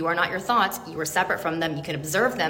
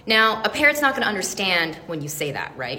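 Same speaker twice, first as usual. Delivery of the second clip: ordinary delivery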